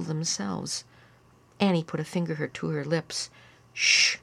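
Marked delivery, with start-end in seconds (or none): 3.7-4.2 firm hushing "shh"; commanding shush. A narrator's reading. Annie hushing her siblings, commanding. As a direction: shush them like the one in charge. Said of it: "she commanded".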